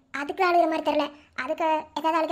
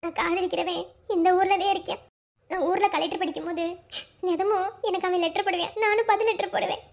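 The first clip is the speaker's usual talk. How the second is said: normal talking voice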